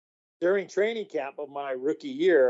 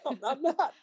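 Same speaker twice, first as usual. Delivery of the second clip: much higher, incredulous denial; high-pitched storyteller's protest; retold protest; 11 semitones higher, 4 dB quieter than usual. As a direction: squeak out a mock protest. Retold protest to disbelieving guard.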